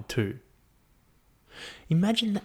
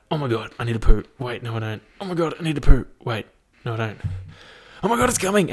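mock panic; 5 dB louder, faster than usual. First woman ever going into labour, mock panicking.

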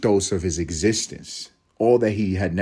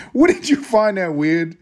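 put-on surfer kid voice; 9 semitones higher, 6 dB louder than usual. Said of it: "Talking like fucking Otto".

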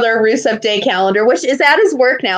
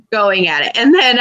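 ordinary talk, nothing marked

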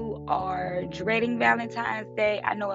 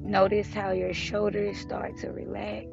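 calm breathing guide, fading softer; guided meditation; 6 dB quieter than usual. Acted. Leads a breathing check-in.

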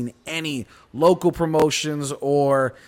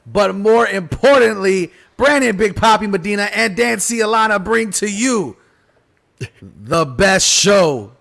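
ringmaster; 5 semitones higher, 5 dB louder than usual. Acted. Ringmaster-style show intro.

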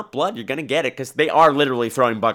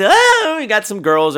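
rowdy audience member; 8 semitones higher, 6 dB louder than usual. Retold or imitated. Audience shouting at horror screen.

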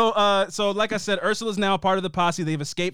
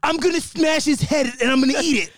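higher-pitched, worked-up fighter boast; C T impression; 5 semitones higher than usual. Imitating a reality star bragging after a fight, C T impression. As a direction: rant like an unhinged brawler. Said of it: "legit C T impression".

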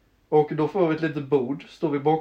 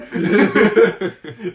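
burst of laughter; 5 semitones higher, 9 dB louder than usual. Real, not acted. Laughing at the story.

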